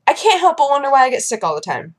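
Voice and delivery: ordinary talk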